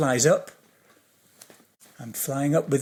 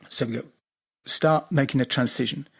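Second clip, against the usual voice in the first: normal talking voice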